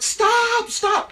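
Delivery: very high, loud plea; panicked shout. Acted. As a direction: yell desperately for it to end. Loki begs the eagle.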